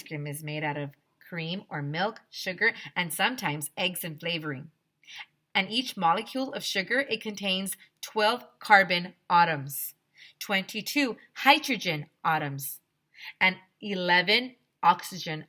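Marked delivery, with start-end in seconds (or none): none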